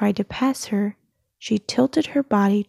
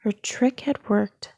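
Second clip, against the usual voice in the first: ordinary delivery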